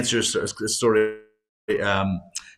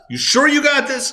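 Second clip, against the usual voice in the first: much higher, incredulous ask; doubting shepherd; 13 semitones higher than usual. Retold or imitated. Shepherd doubting the sheep, pitched up high and incredulous. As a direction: press them, sure?. What do you think the shepherd would say?.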